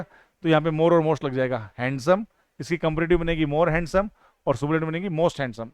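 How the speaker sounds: ordinary delivery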